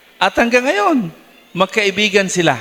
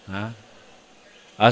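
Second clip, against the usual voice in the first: normal talking voice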